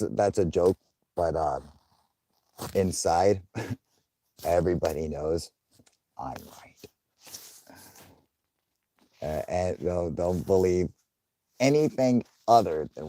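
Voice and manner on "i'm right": whispered aside. Real, not acted. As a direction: whisper it like a secret.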